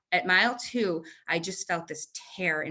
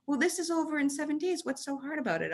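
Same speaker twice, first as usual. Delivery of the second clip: mocking race director voice; 9 semitones higher than usual. Retelling Dave's scoffing remark.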